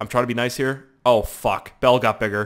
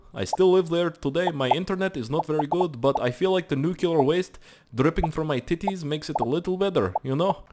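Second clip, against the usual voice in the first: higher-pitched put-on Russian accent; mock Russian accent; 5 semitones higher than usual. Chernobyl survivor greeting friends, mock Russian accent. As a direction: plead like a comic Russian from Chernobyl.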